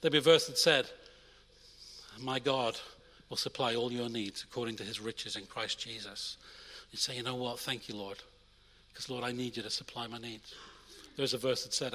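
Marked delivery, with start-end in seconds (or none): 2.2-6.4 soft scripture reading. Acted. Verse quoted softly. 7.2-10.5 quiet prayer. Pretend. Imagined prayer to the Lord.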